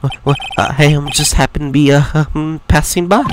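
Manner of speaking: faltering excuse full of ums; hesitant, broken, anxious stutter; nervous stammerer. Acted. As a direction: stumble through a feeble excuse. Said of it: "This guy is so nervous".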